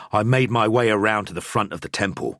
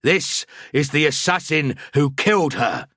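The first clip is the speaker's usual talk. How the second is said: louder, higher, pressing urgency; urgent priest; 8 semitones higher, 3 dB louder than usual. A narrator's reading. Priest pulling his friend away, urgent and louder.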